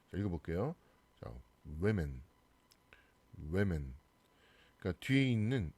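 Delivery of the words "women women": "women" as WEM-en or WIM-in; "women" starting wi, not woo; front vowel in women. Shown as a demonstration. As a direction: say "women" with a short i-like first vowel. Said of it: "이 발음으로 바뀌죠".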